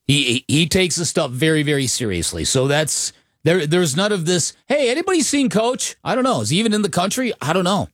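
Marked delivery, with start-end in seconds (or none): 4.7-7.9 mock careless coach. Mocking an absent, careless coach situation.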